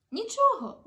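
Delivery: high, loud burst of nothing to it; eager reassurance. Acted. Children reassure the old wizard, eager and high. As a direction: jump in keen to help.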